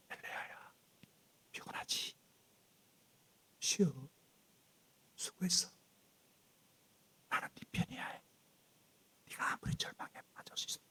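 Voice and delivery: whispered comfort, almost no voice; tender whisper. Acted. God whispering to exhausted Elijah, tender whisper. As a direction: whisper like to a worn-out child.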